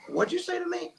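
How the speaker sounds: high, challenging brother voice; mock-indignant brother pitched high; brother confronting cop.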